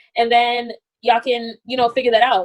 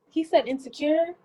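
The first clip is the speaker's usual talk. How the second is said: ordinary delivery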